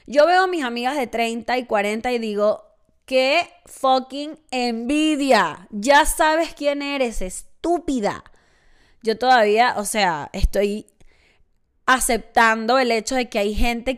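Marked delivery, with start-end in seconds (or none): 3.0-8.2 very slow, dragged-out, higher jealous insult; mock envious jab. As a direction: drag it out like a playful envious insult. Envying older friends, mock insult.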